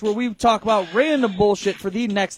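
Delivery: loud, pumped-up show intro; announcer voice. Acted. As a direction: hype up the crowd like a host. Hyped podcast intro announcement.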